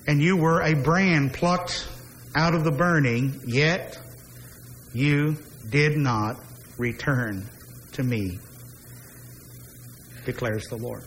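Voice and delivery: measured, solemn reading of scripture, the refrain slowed; solemn Bible reading with a slowing refrain. Acted. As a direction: read the passage gravely, letting each refrain land. Reads Amos passage aloud.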